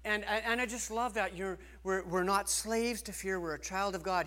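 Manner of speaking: ordinary talk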